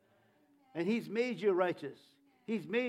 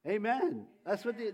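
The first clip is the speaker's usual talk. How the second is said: ordinary talk, nothing marked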